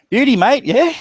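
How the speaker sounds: squeaky, loud, high mock accent of the driver; mock Aussie slang. Retelling the driver's morning beer offer, high mock Aussie accent.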